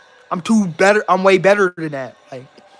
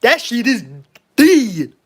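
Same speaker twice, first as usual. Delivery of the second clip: punchy, louder declaration; confident catchphrase; 3 semitones higher, 3 dB louder than usual. Acted. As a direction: mimic a movie catchphrase with confidence. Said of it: "we gotta say it with confidence".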